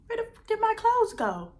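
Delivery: high, incredulous; shocked disbelief. Retold or imitated. Shocked at missing clothes.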